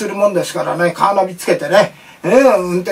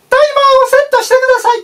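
much higher, louder, fast chirpy machine voice; effeminate appliance voice; 4 dB louder, faster than usual. Retold or imitated. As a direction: play a rice cooker that talks like a camp man. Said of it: "おかまが喋るんですよ".

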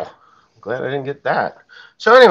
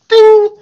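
mock bell ding; 13 dB louder than usual. Imitating a bone snapping.

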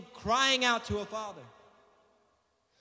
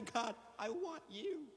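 retold tearful prayer; 10 dB quieter, slower than usual. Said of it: "I just remember weeping tears".

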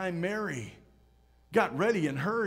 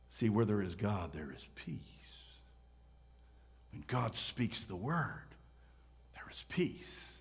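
low, soft, fading into a whisper; hushed, peaceful; 8 semitones lower, 8 dB quieter than usual. Real, not acted. Preacher hushes on God's peace.

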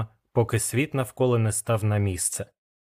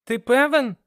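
very high, doubting question; unconvinced, high-voiced query; doubtful; 13 semitones higher, 4 dB louder than usual. A narrator's reading. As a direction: ask with doubt, pitch leaping up. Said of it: "запитав я з сумнівом".

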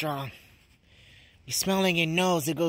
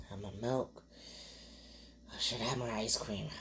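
whispering; 4 semitones lower, 9 dB quieter than usual. Real, not acted. Nervous before eating hot pepper.

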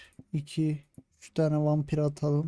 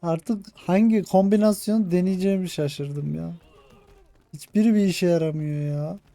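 exasperated rant; 5 semitones higher, 3 dB louder than usual. Real, not acted. Exasperated over failing tactics.

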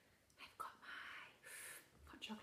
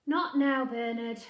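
loud, higher brush-off; dismissive mother; 4 semitones higher, 21 dB louder, slower than usual. Acted. Mother brushing off Bernard, dismissive. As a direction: shoo the child away.

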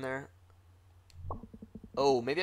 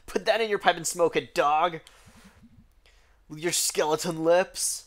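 mock taunt; 3 semitones higher, 4 dB louder than usual. Taunting the dog.

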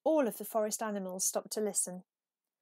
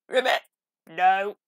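a louder croaky frog call; croaking frog; 10 dB louder than usual. A narrator's reading. Narrator voicing the frog answering Peep with a loud croak. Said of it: "croaked the frog".

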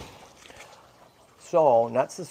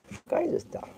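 pet talk; 12 semitones higher, 8 dB quieter, faster than usual. Real, not acted. Showing the squirrel its food.

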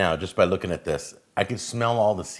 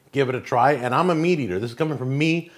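ordinary delivery

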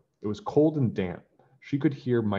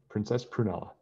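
ordinary delivery, nothing marked

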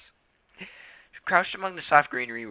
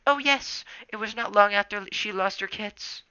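put-on earnest old she-cat; 10 semitones higher, 4 dB louder than usual. A narrator's reading. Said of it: "Dappletail mewed earnestly".

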